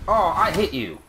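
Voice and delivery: thrilled shout at a hit; excited. Real, not acted. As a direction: yell it with gamer joy. Lands hit in game.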